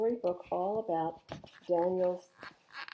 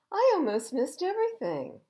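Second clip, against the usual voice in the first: high, louder relieved exclaim; amazed; 10 semitones higher, 8 dB louder than usual. A narrator's reading. Thomas admitting he nearly missed the view, amazed. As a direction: gasp at what he nearly missed. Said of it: "he exclaimed".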